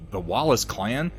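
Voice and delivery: ordinary talk, nothing marked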